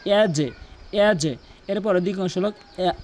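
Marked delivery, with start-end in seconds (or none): 0.1-1.3 ইয়াজে with the j bounced into a short "e"; downward bounce. Shown as a demonstration. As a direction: push the end of ইয়াজে sharply downward. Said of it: "নিচের দিকে ধাক্কা হবে". (ইয়াজে-তে নিচের দিকে ধাক্কা)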